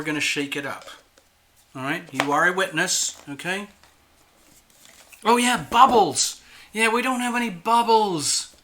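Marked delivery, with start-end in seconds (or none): 5.2-8.5 sudden realisation. Real, not acted. Suddenly remembers missing bubbles.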